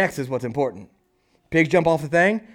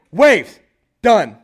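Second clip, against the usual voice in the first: firm, louder command; 4 semitones higher, 8 dB louder than usual. Retold or imitated. Jesus commanding the winds and the waves to stop.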